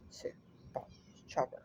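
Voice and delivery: low hushed order, barely voiced; whispered command. Owner secretly orders her dog to attack, whispered.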